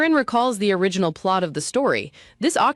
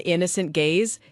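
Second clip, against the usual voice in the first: ordinary delivery, nothing marked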